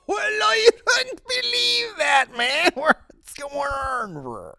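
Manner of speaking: very high, drawn-out wailing Irish caricature; hammed-up Irish accent. Host parodying an actor meeting a hometown fan, wailed Irish accent. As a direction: wail it slowly in a cartoonish brogue, sliding down.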